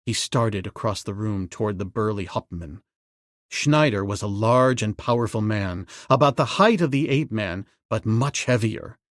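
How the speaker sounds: ordinary delivery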